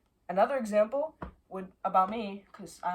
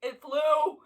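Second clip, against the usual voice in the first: high, loud frightened cry; 7 semitones higher, 7 dB louder than usual. Real, not acted. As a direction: cry out as it flies. Bug flies at her.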